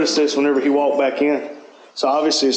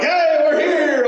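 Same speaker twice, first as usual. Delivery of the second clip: excited greeting; 13 semitones higher, slower than usual. Retold or imitated. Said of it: "they're all like".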